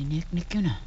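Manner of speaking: slow plotting whisper; scheming whisper. Acted. Efren whispers his scheme to himself. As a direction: whisper it slowly, hatching a plan.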